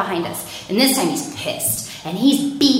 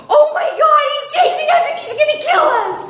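high-pitched hysterical shriek; panicked scream; 6 dB louder than usual. Retold or imitated. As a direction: scream it in sheer panic, hammed up. Panicked 911 call retold.

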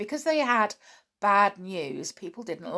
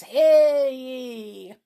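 town crier; 7 semitones higher, 7 dB louder than usual. Pretend. Final town crier call, laughing.

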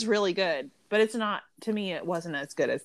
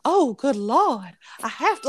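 mock an overdone antebellum Southern belle accent; hammed-up old Atlanta, Georgia accent; 6 semitones higher, 4 dB louder than usual. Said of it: "sound like they are from Atlanta, Georgia, like the antebellum".

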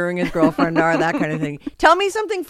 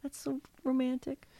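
whispered, softer mock gossip; teasing whisper; 5 semitones higher, 13 dB quieter than usual. Teasing about the shared dinner.

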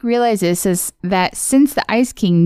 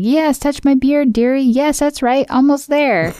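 high creepy Ice King; 7 semitones higher than usual. Retold or imitated.

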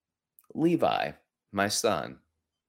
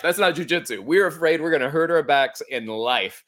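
ordinary talk, nothing marked

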